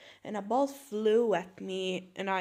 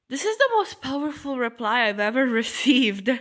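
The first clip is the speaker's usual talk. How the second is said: loud, amused announcement breaking into laughter; barely holding back a laugh; laughing delivery; 2 semitones higher, 6 dB louder than usual. Acted. Reacting to a post, laughing.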